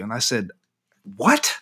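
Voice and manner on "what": very high startled what; amazed singer hearing a guard wants his picture; astonished what. Retold or imitated. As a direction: yelp it in disbelief. Asked for a picture, stunned.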